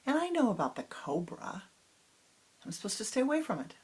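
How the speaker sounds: put-on an excited little girl insisting to grandma. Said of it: "And the little girl says".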